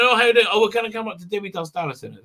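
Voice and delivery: usual speaking voice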